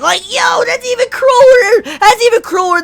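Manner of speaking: high, loud, cracking outrage; outraged cry. Real, not acted. Fan reacting to Subaru's cruelty, high cracking outrage. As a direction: yelp it like you can't believe it.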